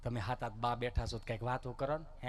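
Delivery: understated first try; low-voiced request. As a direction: ask it low and subdued. Question asked, then repeated after "હે?.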